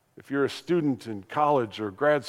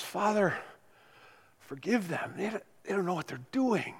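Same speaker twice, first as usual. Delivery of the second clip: softer, higher, fading breaths; Jesus on the cross, faint last words; faint dying plea; 5 semitones higher, 7 dB quieter than usual. Acted. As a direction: speak it with almost no breath left.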